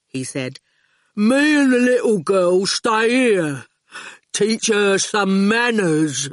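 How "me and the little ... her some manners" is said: slow, heavy-witted thug, words dropped one by one; menacing henchman taking his time; slow lumbering thug; slow goon voice. Acted.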